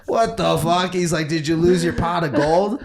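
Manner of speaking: loud, high mocking jeer from the coach. Coach mocking the kid in green, loud jeer. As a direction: razz the kid in green loudly.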